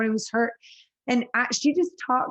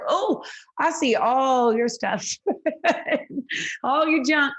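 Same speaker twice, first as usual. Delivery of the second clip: laughing mock complaint; 4 semitones higher, slower than usual. Mock complaint about family mess.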